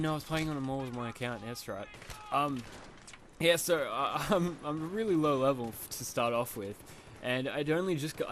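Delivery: ordinary delivery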